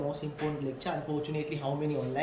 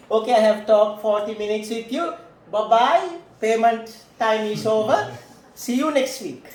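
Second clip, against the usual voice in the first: louder, brisk dismissive counselor; mock lazy counselor; 3 semitones higher, 9 dB louder than usual. Lecturer mocks a counselor dismissing the client once payment time ends, louder. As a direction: wave the client off after forty minutes.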